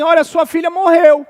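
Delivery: loud, high blurted news; shouted announcement. Acted. Messengers shouting to Jairo that his daughter died.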